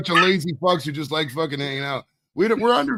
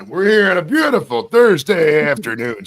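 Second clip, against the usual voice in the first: loud, slow, raised-pitch announcer holler; yelled station break; 5 semitones higher, 8 dB louder than usual. Pretend. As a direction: belt it out like a radio host. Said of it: "I just gotta yell".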